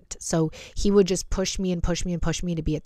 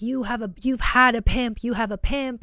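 raised, higher-pitched imitation of him snapping; half-acted snapping, pitched up; retold angry accusation; 6 semitones higher than usual. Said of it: "freaking cussed me out".

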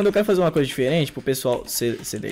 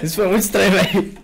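real laugh through the words; 3 semitones higher, 4 dB louder, faster than usual. Real, not acted. Laughing at an odd moment.

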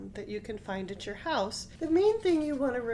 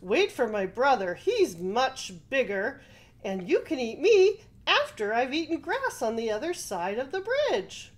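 louder, persuading goat; 4 semitones higher, 6 dB louder than usual. Acted. Goat persuades the troll to wait for his brother. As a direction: coax the troll into waiting.

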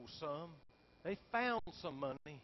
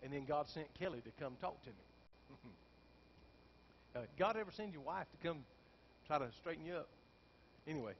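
ordinary delivery

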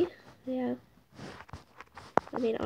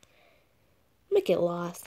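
normal talking voice

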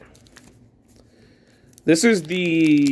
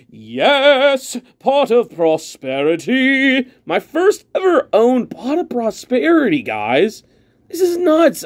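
higher, gushing over the pull; delighted over his first ever card; excited; 8 semitones higher than usual. Real, not acted. Thrilled at first Pot.

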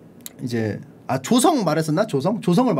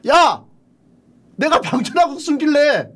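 higher, louder, a petulant shout; whiny loud protest; 8 semitones higher, 5 dB louder than usual. Retold or imitated. Song Xian begging his friends for a better job, in a loud, whiny protest. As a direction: demand the halberd job like a sulking kid.

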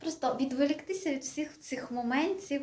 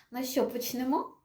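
ordinary talk, nothing marked

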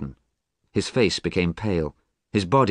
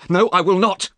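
much higher and louder, a flat no; angry shout; 13 semitones higher, 7 dB louder than usual. Acted. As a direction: shout the refusal right at him. Alan flatly refuses Dorian.

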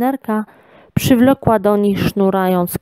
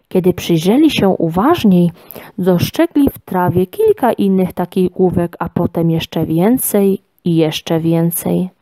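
ordinary delivery

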